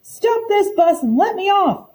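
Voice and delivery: put-on high-pitched childish shout; panicked girl yelling to be let off. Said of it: "I shouted".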